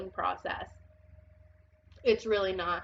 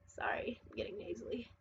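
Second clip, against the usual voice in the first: very soft, barely voiced apology; hushed aside; 13 dB quieter than usual. Real, not acted. Reader excusing herself to Earth signs while getting nasally, hushed aside. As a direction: drop right down, almost to a whisper.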